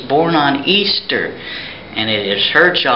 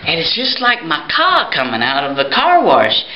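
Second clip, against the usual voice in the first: normal talking voice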